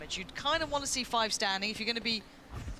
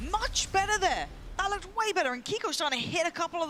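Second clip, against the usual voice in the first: excited; 8 semitones higher than usual. Real, not acted. Caster praises a clean round.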